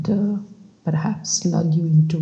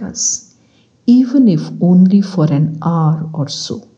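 measured, louder story-reading voice; bedtime reading; 5 dB louder than usual. Acted. Meditation guide reads a book extract to sleepy listeners, measured reading. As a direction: read the extract aloud in unhurried phrases.

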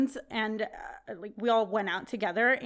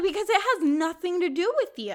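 higher, louder indignant retort; retold straight-up telling-off; 7 semitones higher, 4 dB louder than usual. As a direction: lay out each grievance with mild indignation. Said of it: "I told her straight up".

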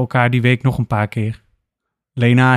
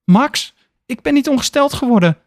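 panicked call; 12 semitones higher than usual. Acted. Lena phoning her friend Max in panic, high frantic cry. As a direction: call out in panic the moment he picks up. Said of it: "roept ze door de telefoon".

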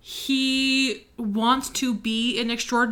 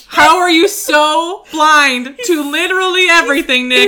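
loud, raised, exasperated plea; mock exasperation; 4 semitones higher, 8 dB louder than usual. Reader pleading with the oblivious Nick, playfully exasperated. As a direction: plead loudly with a clueless hero.

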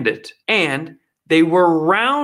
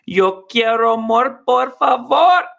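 put-on higher, slow, hammy Spanish accent; hammed-up Spanish accent, begging for more food; mock Spanish accent; 7 semitones higher, slower than usual. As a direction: order more in a playful fake Spanish accent. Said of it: "I'd be first in line saying".

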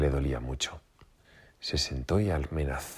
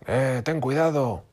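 high, loud warning shout; 9 semitones higher, 5 dB louder, slower than usual. A narrator's reading. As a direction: yell at him to watch out. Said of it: "gritó".

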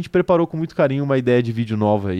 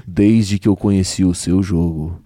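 deep, slow radio love dedication; sultry narrator; 10 semitones lower, slower than usual. Pretend. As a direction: croon it like a radio DJ. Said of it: "É algo tipo assim".

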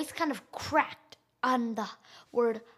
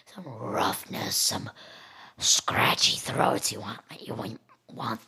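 low, rough whispered alien voice; demonic alien voice; 14 semitones lower than usual. Shown as a demonstration. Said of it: "make a very demonic alien voice".

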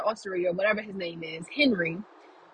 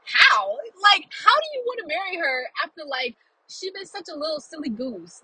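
retold incredulous outburst, pitched up at first; incredulous out loud; 6 semitones higher than usual. Moviegoer objecting out loud to the hero's proposal, incredulous. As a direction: blurt it in mock disbelief. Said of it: "I said out loud".

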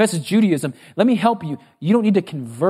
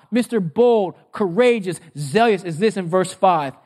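ordinary talk, nothing marked

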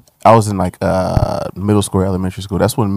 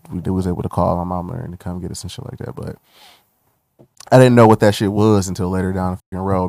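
ordinary talk, nothing marked

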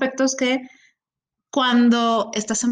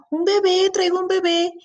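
put-on higher, sing-song reply to a toddler; sweet explaining; 8 semitones higher than usual. Aunt answering the three-year-old, high and sweet. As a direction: explain it sweetly to a little one. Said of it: "Y le digo yo".

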